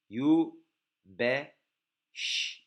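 Yu, be, ş split slowly into separate sounds; letter by letter. Shown as a demonstration. Teacher spells the word out sound by sound for students. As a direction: break the word apart syllable by syllable. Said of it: "Bakın Y, B, Ş, R harflerimiz var".

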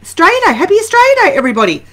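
high, loud, bright-eyed cheer; excited angel greeting. Retold or imitated. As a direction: announce it like a joyful herald. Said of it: "Angel said".

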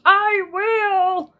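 high, loud sheepish promise; sheepish and eager to please; a badger promising sheepishly; embarrassed Badger. A narrator's reading. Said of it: "a little embarrassed".

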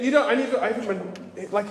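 ordinary talk

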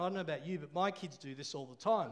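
normal talking voice

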